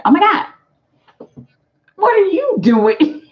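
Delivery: high, loud squealing "oh my God"; gush, delighted he showed up; excited surprise. Pretend. Client thrilled, voiced playfully.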